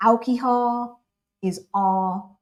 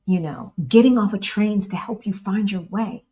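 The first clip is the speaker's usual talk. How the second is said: usual speaking voice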